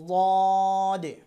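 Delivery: লদে loud, very drawn out, heavy lateral onset; tongue-side dad. Shown as a demonstration. Teacher telling learners to watch closely, long loud dad. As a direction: press tongue side to upper molars, stretch লদে. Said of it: "উচ্চারণটা আমি বলে দিচ্ছি".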